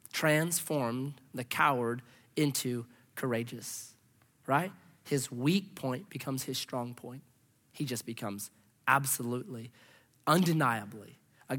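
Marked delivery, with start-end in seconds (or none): none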